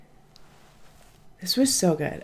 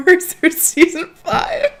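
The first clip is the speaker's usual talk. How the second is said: pitched way up and louder, laughing through it; giddy laughing plea; 11 semitones higher, 6 dB louder than usual. Retold or imitated. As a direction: beg for more, half laughing. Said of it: "I was like".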